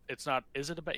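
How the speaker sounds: normal talking voice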